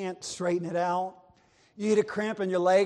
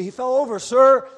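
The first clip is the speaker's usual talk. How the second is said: calling out; 5 semitones higher, 10 dB louder than usual. Acted. Sailor calling to captain.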